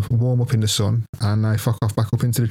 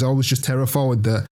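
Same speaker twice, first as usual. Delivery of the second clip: ordinary talk, nothing marked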